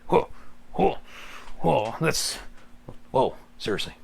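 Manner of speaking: rough whoas in short bursts; Beetlejuice. Acted. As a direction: throw out startled, raspy little bursts. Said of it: "Beetlejuice kinda goes about just like".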